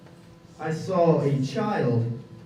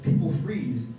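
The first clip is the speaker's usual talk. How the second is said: ordinary delivery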